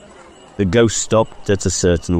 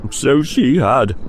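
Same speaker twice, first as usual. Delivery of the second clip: put-on higher, slower old-man cry; old Scrooge moved; 7 semitones higher, slower than usual. Scrooge answering the ghost, stirred and higher. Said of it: "cried Scrooge".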